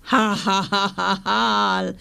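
slow, booming monster laugh; monster laughter. Acted. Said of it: "lachte das Monster".

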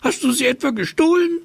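very high, quick mother's voice; rapid, high motherly suspicion; accusing mother. A narrator's reading. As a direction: fire off a high-pitched accusation. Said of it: "fragte die Mutter".

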